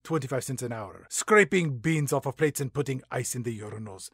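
lightly voiced Latin accent, deadpan. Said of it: "my sexy Latin accent".

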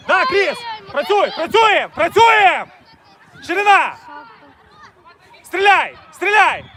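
loud, driving calls across the pitch; shouted encouragement. Real, not acted. Coach urging his players from the touchline, shouting. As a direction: push them on with a full yell.

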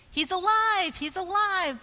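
cry of praise. A narrator's reading. Rocks praise the risen Jesus, exultant cry. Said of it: "the rocks cried in praise".

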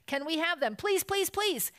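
very high, repeated begging; pleading shout. A narrator's reading. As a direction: plead like excited little cubs. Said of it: "they shouted".

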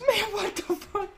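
high squeaky what-the-fuck; mock disbelief. Retelling his own reaction to the friend, high mock shock. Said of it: "And I'm like".

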